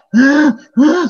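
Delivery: high, loud mock grunts; pent-up groans of exasperation; exasperated groans. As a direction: act out everyone's frustration. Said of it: "gitu, kita semua sampai".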